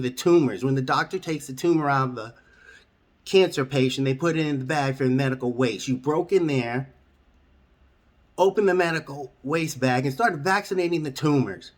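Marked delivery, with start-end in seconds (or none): none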